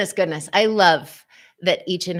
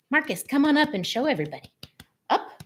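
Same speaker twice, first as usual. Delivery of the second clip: calling the dog; 8 semitones higher, much faster than usual. Real, not acted. Calls dog up to camera.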